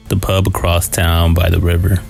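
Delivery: ordinary delivery, nothing marked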